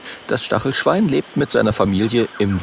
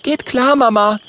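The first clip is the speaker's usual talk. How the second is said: higher, louder answer; Mathilda's voice; 4 dB louder than usual. Acted. Mathilda answers her mother, higher voice. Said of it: "sagt Mathilda".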